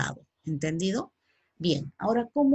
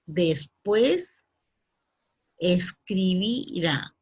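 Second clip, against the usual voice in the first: "escribirá" drawn out slowly; slow model answer; much slower than usual. Shown as a demonstration. Teacher modelling the future tense slowly. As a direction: stretch each syllable of "escribirá" for the class. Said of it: "¿qué hará después?".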